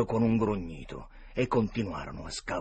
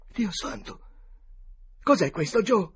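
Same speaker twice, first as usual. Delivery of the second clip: horrified gasp; 12 semitones higher, 6 dB louder than usual. A narrator's reading. Potter wakes to a corpse.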